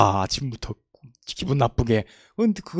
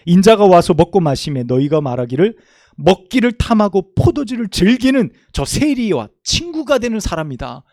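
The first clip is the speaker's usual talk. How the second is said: loud, raised reading of the verse; fervent Bible recitation; scripture reading; 2 semitones higher, 7 dB louder than usual. Acted. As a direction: proclaim the scripture to the congregation. Reads scripture aloud to congregation.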